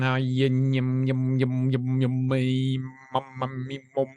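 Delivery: a fumbling non-answer in mumbles; mumbled nonsense sounds. Retold or imitated. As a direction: mumble nonsense syllables under the breath. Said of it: "I was doing them sounds".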